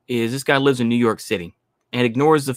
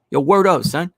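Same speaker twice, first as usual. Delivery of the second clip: put-on brash, higher New York street accent; fake New York accent; 5 semitones higher than usual. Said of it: "throw on my fake New York accent".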